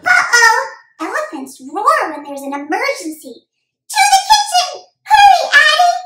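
put-on high, loud, urgent kid voice; boy rallying his dog in an emergency; alarmed young boy.

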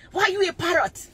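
acted exasperated parent; scolding parent. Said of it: "but you're like".

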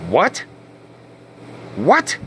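rising disbelieving what; baffled double take, pitch climbing; what said in shocked disbelief; stunned what. Retold or imitated. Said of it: "I mean, it's that weird".

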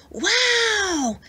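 put-on high, amazed boy's wow. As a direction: gasp it out in wonder. Boy amazed at the beach.

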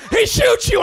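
high, loud announcement; sharp shout. Retold or imitated. Improv partner shouts the plot twist. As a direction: shout it out flat and sudden. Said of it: "and he goes".

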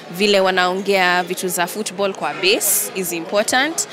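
ordinary talk

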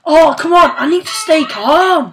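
loud, exasperated pleading; worked up. Real, not acted. Gamer telling himself to stay calm, worked up. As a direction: struggle to calm down while getting heated. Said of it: "I'm getting all worked up".

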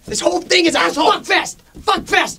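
high, loud ranting; shouting at the band in a meltdown; angry meltdown. Acted. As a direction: lose it and yell at everyone. Meltdown yelling.

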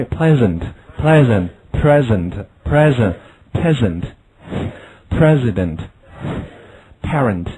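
very slow run through similar words; slow contrast drill. Shown as a demonstration. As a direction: separate each word clearly for the ear. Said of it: "再来一遍".

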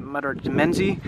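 ordinary delivery

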